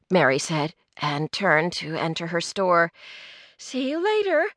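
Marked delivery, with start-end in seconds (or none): 3.6-4.5 high, soft sing-out; light call, as from a doorway. A narrator's reading. Mary leaves for her store, calling to the others. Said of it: "she called to the others".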